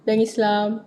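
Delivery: ordinary talk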